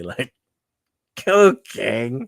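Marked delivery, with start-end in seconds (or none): 1.3-2.3 silly, loud mock address. Three men answering the king, made deliberately silly. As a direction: make a goofy show of addressing royalty. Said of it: "make it more silly".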